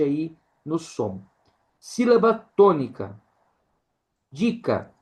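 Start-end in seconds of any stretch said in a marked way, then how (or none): none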